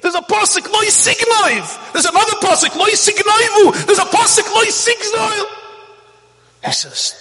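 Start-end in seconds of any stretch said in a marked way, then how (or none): 0.0-5.5 screaming owner. Retold or imitated. Vineyard owner yelling from the porch at the tourist, screaming. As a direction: scream it at him like an outraged farmer. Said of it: "starts screaming".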